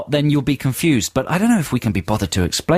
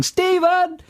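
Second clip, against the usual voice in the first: very high-pitched comic call; mock shout. Worker can't help shouting colleague's name, high mock shout. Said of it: "without shouting".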